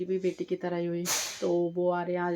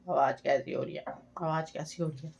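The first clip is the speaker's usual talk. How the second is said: sore throat; 4 semitones lower, 7 dB quieter than usual. Real, not acted. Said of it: "शायद मेरा गला ख़राब हो गया".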